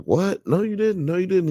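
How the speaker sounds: high-pitched, incredulous denial; retold disbelief. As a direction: protest in mock disbelief, voice shooting up. Retold reply of disbelief to confession.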